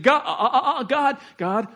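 high, loud and frantic, begging God; desperate pleading prayer. Acted. Jacob begging God to keep his promise, frantic and loud. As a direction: cry out to God as if your brother is coming to kill you. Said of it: "So he's saying".

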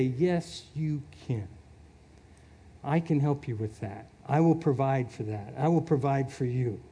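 soft, low reassurance; reassuring God. Acted. God answering the tired believer, soft and reassuring. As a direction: gently promise help, unhurried and calm.

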